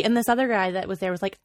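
normal talking voice

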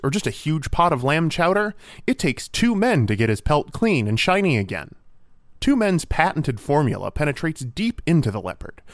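punchy, emphatic commercial announcer; mock ad read. Host pitching a leopard cleaner, mock ad read. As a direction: sell it like a product pitchman.

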